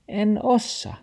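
high dog voice; high small refusal. Acted. The dog refuses grandpa, high.

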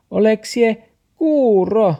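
high, loud, slow dog voice; scolding dog voice. Acted. The dog scolds Max, high, loud and slow. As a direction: bark it out slowly. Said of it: "taksi jylkytti".

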